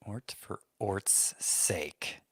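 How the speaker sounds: slow, soft whisper to himself. Real, not acted. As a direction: whisper it, puzzling it out. Repeats team name under breath.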